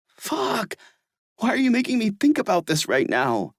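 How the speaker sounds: high, exasperated cry; frustrated. Acted. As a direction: snap at him in frustration. Everett's frustrated outburst.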